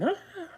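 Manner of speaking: very high falsetto mock scream. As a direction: imitate a high-pitched yelp without extra volume. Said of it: "sound like [name]".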